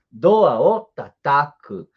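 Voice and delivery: teacher models Doa o tataku very slowly; slow Japanese demo. Shown as a demonstration. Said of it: "É outro verbo".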